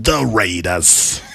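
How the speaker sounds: low, slow, drawn-out announcer voice; Chris Berman impression. Doing a Chris Berman impression for a co-host, deep and slow. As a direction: stretch out the Raiders like Berman. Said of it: "Is that how he says it?".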